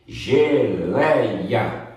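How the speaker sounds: "Geleia" slowly stretched on an open éi; open diphthong, drawn out. Shown as a demonstration. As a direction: draw out the middle of "Geleia" with an open é. ("Geleia" alongado, com éi aberto)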